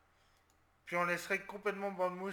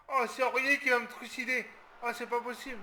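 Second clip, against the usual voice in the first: louder, higher cry of disbelief; exasperated outburst; 5 semitones higher, 7 dB louder than usual. Real, not acted. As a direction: vent at the blunder. Aurier rate, il s'exaspère. (éclat d'exaspération, plus fort et plus aigu)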